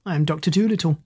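usual speaking voice

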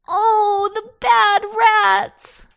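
put-on loud, high little-girl bawl; hammed-up crying child; tearful wail. A narrator's reading. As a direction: wail it out slowly, bursting into tears. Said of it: "bursting into tears".

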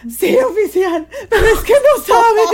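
high, wailing mock sob to the cop; fake crying plea. Faking tears to the traffic cop to get out of a fine. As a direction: ham up a teary excuse. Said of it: "ya estoy llorando".

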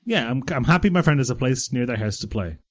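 ordinary talk

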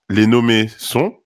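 ordinary talk, nothing marked

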